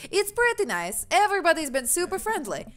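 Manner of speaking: high, lilting attempt at Australian; British accent slipping into Australian; chirpy, badly aimed Australian accent; botched British-turned-Australian. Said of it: "he's Australian".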